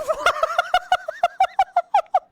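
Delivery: high-pitched burst of disbelieving laughter; high incredulous laugh. Real, not acted. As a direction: laugh out at the absurdity. Laughing at the gameplay.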